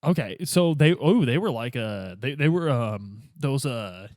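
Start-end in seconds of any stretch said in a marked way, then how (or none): none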